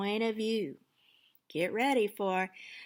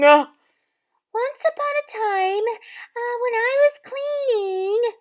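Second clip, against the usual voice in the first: put-on much higher, excited young girl; chirpy girl voice; 13 dB louder, slower than usual. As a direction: squeak it like a dreamy girl. Cindy voiced.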